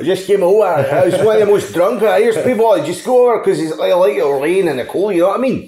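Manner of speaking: put-on high, loud drunk Glasgow accent; hammy drunk Glaswegian, half laughing. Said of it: "to hear an Irishman do a Scottish accent".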